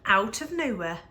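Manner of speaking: plain reading voice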